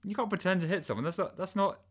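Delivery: high-pitched incredulous protest; exasperated objection. Acted. Correcting the bad cop, high exasperated voice. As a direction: argue back in high disbelief. Exasperated at pretend hitting idea.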